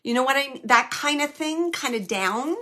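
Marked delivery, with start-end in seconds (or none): none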